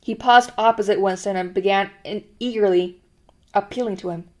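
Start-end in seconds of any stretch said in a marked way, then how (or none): none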